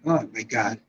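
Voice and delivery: soft, low "oh my God"; retold hushed shock. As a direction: drop to a low, stunned hush. Retold reaction to terrible news.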